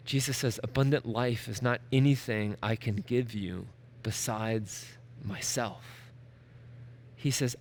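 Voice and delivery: soft, low intimate sermon voice; hushed preaching. Acted. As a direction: draw them in close, speaking softly.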